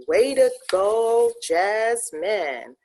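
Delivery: loud, slow, high call of praise; cheering. Real, not acted. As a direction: shout your praise across the room. Host cheers on a graduate.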